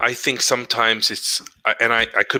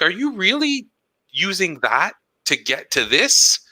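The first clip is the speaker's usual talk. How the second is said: incredulous; 8 semitones higher than usual. Retold or imitated. Retelling a talk with the principal, incredulous and high. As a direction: stretch out the disbelief, pitched way up. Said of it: "it's like".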